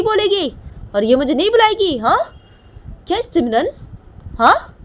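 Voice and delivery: high, loud furious scolding; angry scolding mother. Acted. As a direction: snap at the daughter sharply, voice climbing. Mother scolds daughter in anger.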